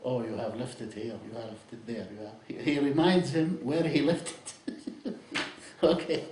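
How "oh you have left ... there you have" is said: low, hushed voice, softening toward the end; half-whispered suggestion, voiced and kept down; imitated whisper. Shaitan reminding a man at prayer where he left it, mock whisper. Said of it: "Shaitan will whisper to me".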